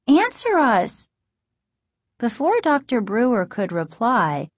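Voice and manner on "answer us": high, slow, drawn-out demand; insistent high girl's voice. A narrator's reading. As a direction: press dad for it, pitched up and slowed. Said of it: "Margaret demanded".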